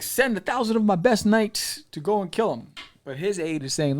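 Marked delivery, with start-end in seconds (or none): 0.0-2.7 high, loud royal command; commanding order. Retold or imitated. Sultan orders his knights to kill Vlad, loud and high. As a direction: bark out the order like an enraged sultan. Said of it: "so he's like".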